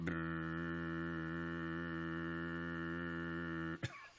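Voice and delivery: long steady buzz imitating a vibrator; vibrator sound effect. Shown as a demonstration. Streamer showing off a sound effect to chat, long vibrating buzz. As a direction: imitate a phone buzzing, held on one droning note. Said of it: "a pretty good vibrator sound effect".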